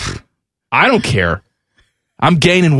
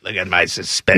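low, softer old broadcaster impression; Larry King; 9 semitones lower, 5 dB quieter than usual. Host mimics the man he's compared her to, low impression. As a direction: drop into a put-on low voice.

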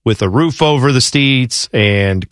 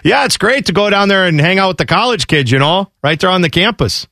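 put-on higher-pitched Canadian hockey-player accent; 5 semitones higher than usual. Hockey player on hanging out with college kids, Canadian accent. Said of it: "I don't know why I'm doing this Canadian accent".

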